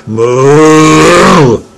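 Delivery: "Mu!" barked out short and loud; Rinzai shout. Retold or imitated. Teacher demonstrating the shouted reading of "Mu!". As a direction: shout "Mu!" like a Zen master's cry. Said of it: "as a Rinzai shout".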